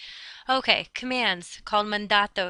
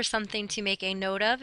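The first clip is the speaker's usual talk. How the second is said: ordinary talk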